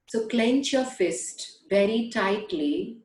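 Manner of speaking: ordinary delivery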